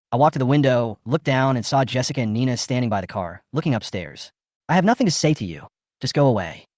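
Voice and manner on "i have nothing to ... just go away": ordinary delivery, nothing marked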